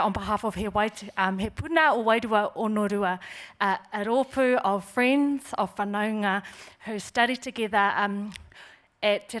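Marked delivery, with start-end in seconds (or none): none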